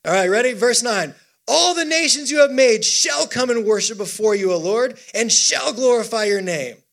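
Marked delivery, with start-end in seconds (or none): 1.5-6.8 raised, emphatic proclamation; psalm reading with gusto. Acted. Preacher urging a sluggish congregation on, reading with gusto. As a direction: declaim it boldly to rouse the room.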